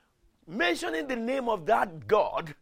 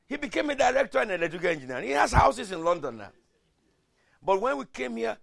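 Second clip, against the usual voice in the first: ordinary talk, nothing marked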